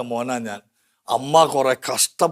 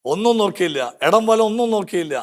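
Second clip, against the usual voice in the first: higher, fervent preacher voice; impassioned preaching; 6 semitones higher, 4 dB louder than usual. Acted. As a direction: rouse the congregation with rising fervour. Preaching Bible story. (ഉയർന്ന സ്വരത്തിൽ ആവേശത്തോടെ പ്രസംഗം)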